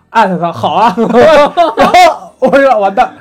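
laughing in dismay. Real, not acted. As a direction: laugh it off as the deal slips away. Someone else grabs the swap. (哭笑不得地大声笑说)

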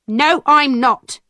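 lightly acted angry mother; slow, mildly angry no; angry denial. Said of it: "my mother answered angrily".

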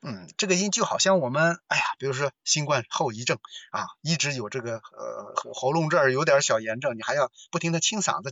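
ordinary delivery

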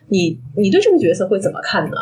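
usual speaking voice

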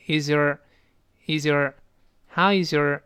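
is your joined as "iz-yer", slow and deliberate; linked is your. Shown as a demonstration. Teacher models linking for learners, is your as one "iz-yer". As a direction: slowly link is into weakened your, also in how is your. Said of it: "辅音碰到半元音会连读，".

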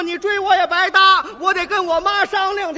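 loud high reply; shouting back. Acted. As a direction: call back at her over the noise. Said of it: "我弟弟说".

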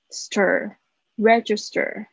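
"stir" alone, then slow "register" with j sound; syllable demonstration. Shown as a demonstration. Teacher shows the sounds and stress of register, slow syllable demonstration. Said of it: "G I的声音是发j的声音".

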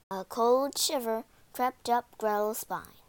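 plain reading voice

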